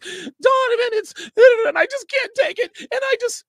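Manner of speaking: very high, loud mock whimpering girlfriend; mock whiny girlfriend. Girlfriend Devin crying at dinner, mock high whine. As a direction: ham up a sobbing partner. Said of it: "She's like".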